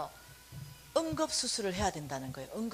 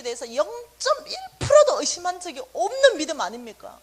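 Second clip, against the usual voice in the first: angry prayer; 5 semitones higher, 7 dB louder than usual. Retold or imitated. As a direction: demand an answer from God. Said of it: "retold night prayer of protest".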